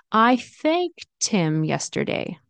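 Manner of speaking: "thanked him" linked as thanktim, slowly. Shown as a demonstration. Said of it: "link 'em together".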